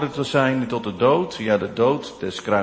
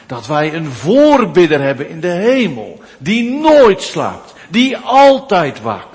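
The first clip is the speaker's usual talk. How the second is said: climactic preaching; 5 semitones higher, 8 dB louder than usual. Acted. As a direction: ring it out over the congregation.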